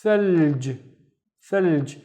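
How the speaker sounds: "thalj" said twice with Arabic th and final j. Shown as a demonstration. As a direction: model "thalj" carefully. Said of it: "and then we have".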